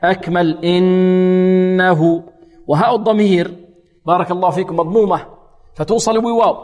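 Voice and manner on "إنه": إنه held very long on the nasal ن; full ghunna. Shown as a demonstration. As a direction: hum the ghunna of إنه fully. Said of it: "والنون غنتها ماذا؟ أكمل". (غنة كاملة)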